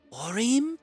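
high, tentative call; new young woman's voice. A narrator's reading. Young visitor seeking her new friend, high tentative call. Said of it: "A new voice spoke out of the murk".

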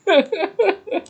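high-pitched run of laughter; amused laugh. Real, not acted. Amused by the Elvira Tree name.

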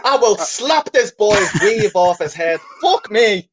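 high, laughing exasperated rant; mock fury. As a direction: explode in laughing mock rage. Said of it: "He's seething".

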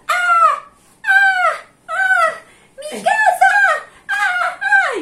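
very high, loud mock screams; imitated screaming woman. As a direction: shriek like a woman who finds her house emptied. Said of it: "escucho a lo lejos que grita una señora".

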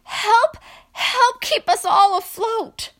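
high, loud cry for help; frightened, high-pitched plea; desperate prayer aloud. A narrator's reading. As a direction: shout for rescue from a sinking boat. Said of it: "they prayed aloud".

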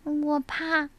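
high, small scared cub voice; little bear cub voice. Acted. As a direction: sound like a frightened little cub. (小熊胆怯的童声)

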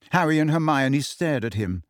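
usual speaking voice

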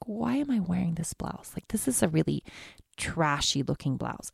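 a quiet, judging voice in her head; inner critic voice. Retold or imitated. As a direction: sneer at your own blouse quietly. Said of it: "and I'm like".

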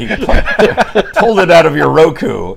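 loud, laughing through the words; cracking up while saying it. Real, not acted. Joke phrase, laughing hard.

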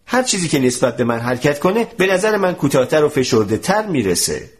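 usual speaking voice

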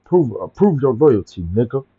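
retold catchphrase. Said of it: "so I was like".

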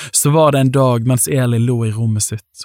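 plain reading voice

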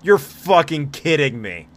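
exasperated outburst. Real, not acted. Game master loses the roll-off, slow and loud. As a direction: spit out each word in frustration.

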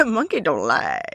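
put-on monkey catchphrase voice, dropping off at the end; mock monkey protest; catchphrase tryout. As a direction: try out a silly monkey line. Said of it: "Just test it out, workshopping it".

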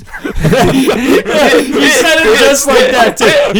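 cracking up at the memory; howling with laughter at Paul's line; laughing. Real, not acted. Cracking up retelling Paul.

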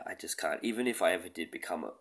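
ordinary talk

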